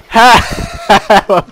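loud mock cry for help; shouting for help. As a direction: call out for help, hammed-up. Calling for help in game.

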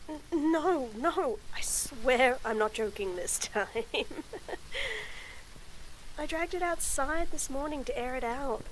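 plain reading voice

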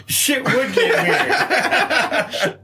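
helpless laughter drawing out the words; cracking up. Real, not acted. Echoing the co-host's punchline, cracking up with laughter. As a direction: say it through big high-pitched laughs.

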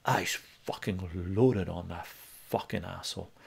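ordinary talk, nothing marked

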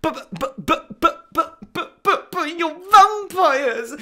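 high stammer building to a cry; rising frantic stammer. Acted.

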